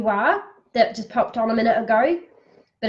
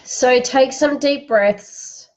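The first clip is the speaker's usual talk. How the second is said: guided breathing; 3 dB louder, slower than usual. Acted. Leads a breathing exercise.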